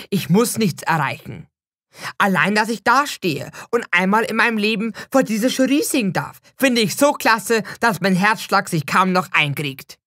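usual speaking voice

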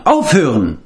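firm repeated call to stop; calling out again. A narrator's reading. Taran repeats his call to stop the quarrel. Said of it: "rief Taran".

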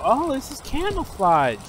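usual speaking voice

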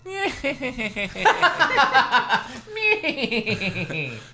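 high, hammed-up villainous giggle; long, loud put-on tittering; exaggerated squeaky giggle. A narrator's reading. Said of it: "He started to giggle".

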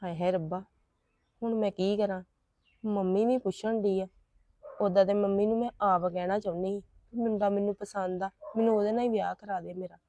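a troubled private thought, spoken under the breath; worried aside. Acted. Daughter to herself about the boy who refused, soft worried aside.

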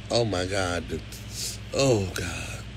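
low, soft, halting groan of prayer; overcome with emotion. Real, not acted. Recalling pictures a battered viewer shared, quietly overcome. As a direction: lose the thread, real feeling weighing it down. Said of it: "I get so emotional".

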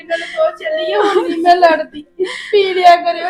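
crying shout. Acted. Wife begging her unconscious husband to come home, wailing shout.

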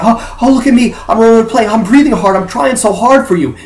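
hammed-up, eager "look at me" straining; mock show of effort. Sports commentator mimicking a player showing off how hard he tries, mocking.

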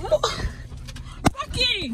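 high falling call of a name. Real, not acted. Calling Rocky after he runs off, high call. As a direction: call the dog back from across the street.

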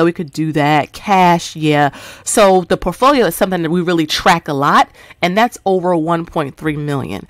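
usual speaking voice

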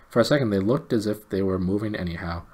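ordinary delivery, nothing marked

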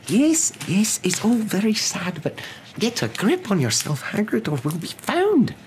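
very high, drawn-out hushing scold; urgent McGonagall. A narrator's reading. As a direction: urge composure in a high, strained voice. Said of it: "Professor McGonagall whispered".

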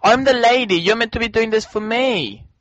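high-pitched, indignant complaint; playful diva outrage; indignant protest. Real, not acted. Mock-indignant complaint to co-player.

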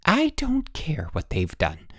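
slow, high-pitched, breathy indignation; indignant interruption. A narrator's reading. Wife will not hear her husband's excuse, indignant interruption. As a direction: cut in, stretching it out indignantly. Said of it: "she interrupted".

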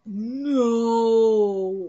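ordinary talk

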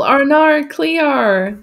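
slow high TV quote; quoting a TV show line. Retold or imitated. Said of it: "that's from H two O".

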